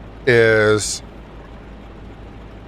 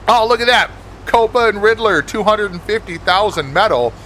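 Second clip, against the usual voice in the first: excited announcement; 8 semitones higher than usual. Real, not acted. Heist alert on stream.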